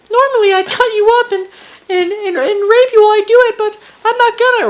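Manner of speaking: very high, loud, playful villain voice; Brian. Acted. Player redoing the villain's playful voice, high and loud. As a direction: make the menace comically high-pitched and full-volume. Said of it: "Wait, yeah, sorry".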